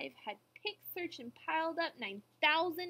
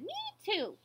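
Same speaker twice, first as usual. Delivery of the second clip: high little joey voice; young kangaroo; 9 semitones higher than usual. A narrator's reading. Young kangaroo echoing mother, high piping voice. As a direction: chime in, small and high. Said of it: "the young kangaroo in her pouch said".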